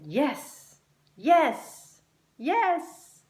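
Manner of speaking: can't wait, bursting with eagerness; eager yes. A narrator's reading. Luke eagerly answering Glowings, rising excitement.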